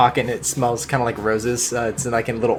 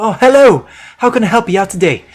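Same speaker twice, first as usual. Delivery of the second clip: higher and louder, an eager merchant's welcome; friendly shopkeeper; 8 semitones higher, 7 dB louder than usual. Acted. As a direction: greet the customer brightly, the second line faster. Shopkeeper greets customer.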